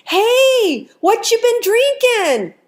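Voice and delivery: very high, loud, drawn-out friendly call; friendly sing-song. Acted. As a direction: call out merrily like a little old man. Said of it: "yells out in the most friendly sing-song voice".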